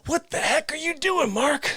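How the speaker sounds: high mock shout of outrage; mock yell. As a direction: bellow it, pretending to be appalled. Shouting at himself after dancing.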